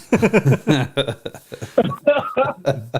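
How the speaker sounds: long delighted laugh; real laugh. Real, not acted. Hunter laughing while retelling his daughter's reaction. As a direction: laugh out at the memory.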